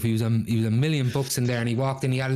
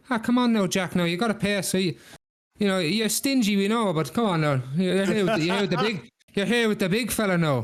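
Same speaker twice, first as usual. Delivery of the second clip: higher, lilting barman's appeal; coaxing barman; 7 semitones higher than usual. Acted. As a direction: wheedle a stingy regular into paying. Barman presses Jack to pay.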